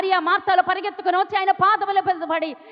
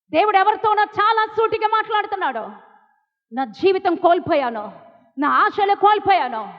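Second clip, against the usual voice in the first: raised, emphatic preacher's voice; forceful preaching; 5 semitones higher, 5 dB louder than usual. Real, not acted. Preacher tells congregation God loves the discarded, forceful. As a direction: proclaim it urgently, phrase by phrase.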